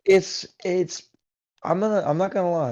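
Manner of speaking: excited. Real, not acted. Excited outburst.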